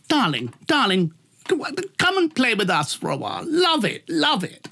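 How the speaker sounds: high-pitched theatrical gush; mock luvvie, high and light; gushing luvvie boss. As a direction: coo it high like a showbiz luvvie. Acting boss's gushing phone offer.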